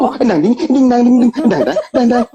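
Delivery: silly sing-song sounds. Pretend. Host fills in with silly sounds.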